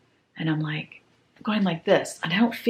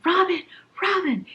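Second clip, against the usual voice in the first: retold yelling; 12 semitones higher than usual. Waking her husband about the bat, a high retold yell. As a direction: call out to wake him, pitched high. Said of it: "So I'm yelling".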